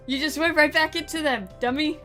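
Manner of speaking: usual speaking voice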